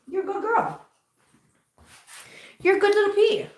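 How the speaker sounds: high-pitched pet praise; cooing praise. Real, not acted. Praising her cat.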